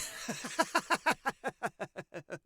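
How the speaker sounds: long, high cackling fit; villain's laugh. Acted. Skullman laughing at the cover-up, gleeful cackle.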